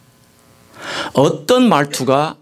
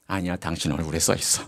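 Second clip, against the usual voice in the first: low, soft retort as the wife; imitated quiet contradiction; 8 semitones lower, 10 dB quieter than usual. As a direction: play the wife softly correcting him, voice dropped low. 아내가 남편의 부인을 조용히 반박. (낮고 조용하게 반박하는 아내 흉내)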